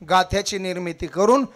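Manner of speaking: loud rising kirtan oratory, each line hammered out; booming devotional sermon cadence; preaching at full force. Acted. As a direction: preach it to the crowd, building every round.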